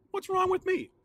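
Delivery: high, squeaky put-on self-doubt; mock high voice. Vlogger mocking his own taste, in a silly high voice. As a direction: question yourself in a silly high voice.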